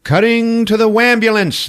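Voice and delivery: high, excited announcement; dramatic scene change. Real, not acted. Narrator cutting to the ambulance, high dramatic call. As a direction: launch loudly into the next scene.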